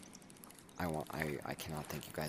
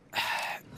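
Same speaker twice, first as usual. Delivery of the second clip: short sigh; 4 dB louder than usual. Real, not acted. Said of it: "it's been emotional for me".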